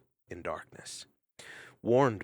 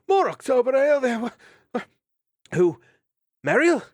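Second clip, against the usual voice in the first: startled awake; 11 dB louder than usual. Acted. Dandin waking startled.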